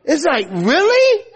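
mock outrage over the last bite; exasperated. Dad's exasperation at child.

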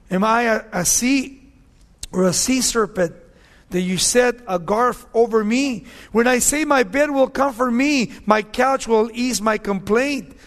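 slowing, weighty scripture read. Acted. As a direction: proclaim the verse from the pulpit. Reads Job's lament.

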